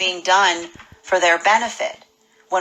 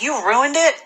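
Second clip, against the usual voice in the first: mock whiny accusation; 6 semitones higher than usual. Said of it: "I can see someone being like".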